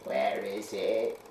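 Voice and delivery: slow, hushed, cracked voice from the grave; low cracked voice. A narrator's reading. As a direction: rasp it slowly like a corpse risen. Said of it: "A low, cracked voice said".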